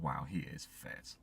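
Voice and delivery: hushed, slow, awestruck sigh at a handsome man; lovestruck gasp. Retold or imitated. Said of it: "she was like".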